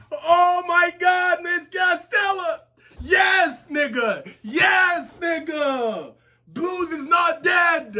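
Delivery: high, drawn-out yells of relief; joyful shouting. Real, not acted. Reader celebrating that Blues lives, joyful shouting. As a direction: shout the joy out, slowly.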